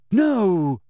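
high, loud denial; upset schoolboy contradicting; upset protest. A narrator's reading. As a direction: blurt it out in protest. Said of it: "looking upset".

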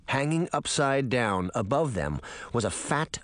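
ordinary delivery

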